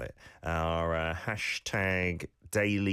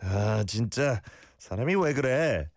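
demonstrated exasperation; exasperated; 3 semitones higher, much faster than usual. Shown as a demonstration. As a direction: complain as if fed up with someone. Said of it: "trying to be exasperated".

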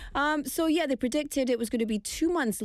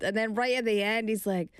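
ordinary talk

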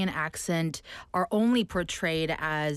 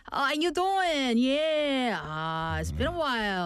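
put-on higher, New York accent catching up with a friend; 6 semitones higher, slower than usual. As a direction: code switch into New York phone chat. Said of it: "on the phone with my New York friends".

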